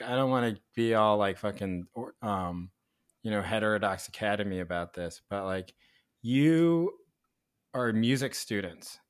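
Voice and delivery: ordinary talk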